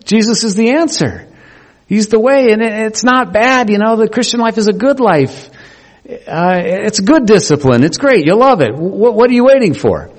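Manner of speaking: high-pitched, pleading call to the crowd; mock rallying shout. Preacher imagines shouting at passers-by, high-pitched mock plea. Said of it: "bang garbage can lids together and shout".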